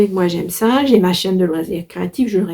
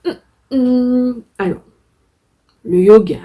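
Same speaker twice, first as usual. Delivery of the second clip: ordinary talk, nothing marked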